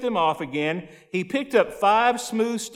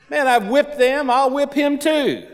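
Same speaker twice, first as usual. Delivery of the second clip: put-on shepherd boy bragging to the king; cocky boast; 6 semitones higher than usual. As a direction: brag it higher and lighter. David voiced boasting to Saul.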